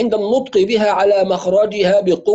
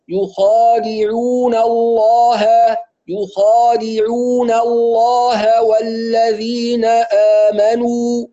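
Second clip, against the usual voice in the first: slow recited يخادعون الله with light nun and heavy lam; teacher modelling the verse; correct recitation; 2 semitones higher, much slower than usual. Shown as a demonstration. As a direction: chant it slowly, nun clear, lam full. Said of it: "هكذا".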